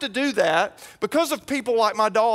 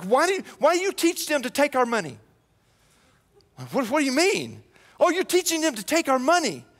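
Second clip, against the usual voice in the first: higher, quick accusation; accusing man; 7 semitones higher, faster than usual. Retold or imitated. Stranger accusing the pastor, high and quick. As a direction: throw the blame at him. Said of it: "he's like".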